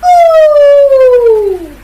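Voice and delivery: a big exaggerated ooh of disdain; loud mock ooh. Bunny's loud exclamation.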